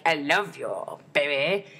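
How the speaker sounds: low, hammed-up lovestruck voice; mock Romeo. Fan mocking cats staring like Romeo and Juliet, low swooning voice. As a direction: drop low for a mock romantic declaration. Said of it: "when they just stared at each other like".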